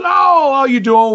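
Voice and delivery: high, drawn-out hello; hearty greeting. Retold or imitated. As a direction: act out hailing a returning pal. Guard greets him back in story.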